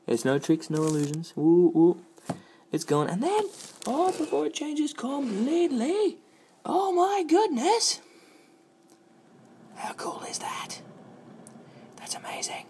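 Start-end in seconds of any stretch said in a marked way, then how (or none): 1.4-8.0 high, loud, drawn-out amazement; excited squeal. Real, not acted. Vlogger watching the mug change, high amazed squeal. 9.7-12.7 hushed secretive whisper. Real, not acted. Vlogger whispering to viewers about the mug, secretive whisper. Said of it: "but don't tell anyone".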